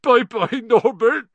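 high, loud tearful goodbye; sobbing. A narrator's reading. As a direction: sob out the farewell. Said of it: "Hagrid sobbed".

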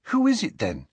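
put-on high-pitched girl's question; Ginny, questioning. A narrator's reading. Ginny asking Harry who he means, curious.

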